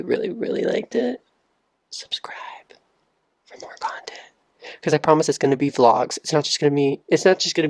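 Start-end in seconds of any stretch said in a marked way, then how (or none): 0.0-1.2 soft, very high voice; playful plea. Pretend. Asking viewers who really liked the video, high and soft. 1.9-4.2 hushed, voiceless whisper; playful whisper. Pretend. Asking viewers to subscribe for more content, in a whisper. Mock-secret plea to subscribe.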